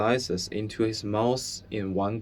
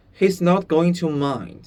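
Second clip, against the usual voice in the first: put-on reassuring boy; 6 semitones higher, 5 dB louder than usual. Bruno reassuring.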